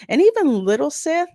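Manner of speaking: ordinary talk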